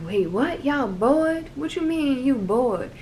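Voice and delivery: high, drawling Southern country voice, incredulous; Southern country accent asking how they could be bored. Retold or imitated. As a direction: play the North Carolina friend in a thick country accent. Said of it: "that's my country accent".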